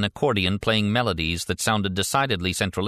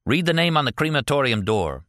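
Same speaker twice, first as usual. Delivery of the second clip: firm, higher-pitched command; ordering; 6 semitones higher than usual. A narrator's reading. Interrogator telling captive to read the door, commanding. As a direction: give it as an order. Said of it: "he ordered".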